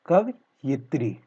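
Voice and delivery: कवयित्री split slowly, pausing after कव; syllable by syllable. Shown as a demonstration. Teacher showing the correct spelling, slowly broken up. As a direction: dictate कवयित्री in two slow chunks. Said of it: "कवयित्री क्या होता है".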